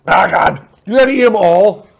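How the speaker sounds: sour pain, mouth full; eating sour lemon. Real, not acted. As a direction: wince through a sour bite.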